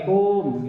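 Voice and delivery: "kum" stretched out on a held vowel; overlong kum. Shown as a demonstration. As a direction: drag the u in "kum" well past its length. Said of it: "Kumnya panjang lagi". ("kum" dipanjangkan)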